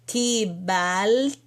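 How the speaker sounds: "Kibalti" slow, with a held rising "bal"; drawn-out syllables. Shown as a demonstration. Teacher assembling the word from its vowels, drawn-out syllables. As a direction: stretch "Kibalti", pausing before "ti". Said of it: "Então, vai ficar ki".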